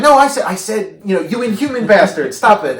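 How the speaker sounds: high, loud, acted-out insistence; urgent loud protest. Ricardo covering for the talking ape, loud mock protest. Man covers for ape's outburst.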